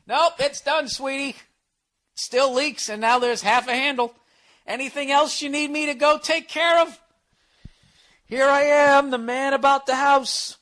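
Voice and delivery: high, loud, mock-proud announcement; sarcastic outburst. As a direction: declare failure as if it were triumph. Acted defensive reply to girlfriend.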